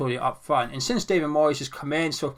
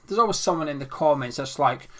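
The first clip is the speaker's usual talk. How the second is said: normal talking voice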